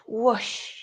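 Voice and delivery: whoosh with a long rushing sh; breathy whoosh. Shown as a demonstration. Yoga teacher showing a big out-breath, whooshing. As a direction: let the word rush out on the exhale. Imitates rush of exhaled breath.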